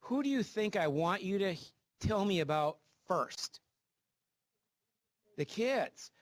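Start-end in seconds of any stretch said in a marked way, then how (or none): none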